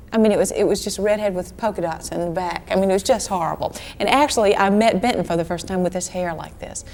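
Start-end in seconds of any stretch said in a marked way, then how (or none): none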